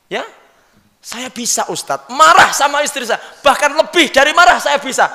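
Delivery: high, loud husband insisting he can; boastful husband. Acted. Husband telling the ustadz he can be angry, insistent.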